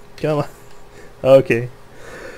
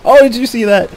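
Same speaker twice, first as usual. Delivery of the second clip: high and loud, thrilled; excited; 11 semitones higher, 8 dB louder than usual. Real, not acted. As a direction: shout it out in delight. Right after the super shot.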